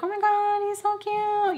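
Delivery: high squealy gushing; gushing girls. Retold or imitated. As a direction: coo over the baby, high. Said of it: "gushing over Andrew".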